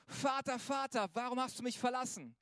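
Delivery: moderately shouted plea; retold cry out. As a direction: cry out to a father, measured. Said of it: "schrie er".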